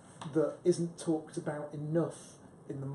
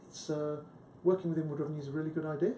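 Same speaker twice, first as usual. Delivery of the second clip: "idea" lifted like a question; quick statement ending in a rise on "idea"; upward inflection; faster than usual. Retold or imitated. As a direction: make the statement sound unsure by rising on "idea". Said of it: "they have this upward inflection".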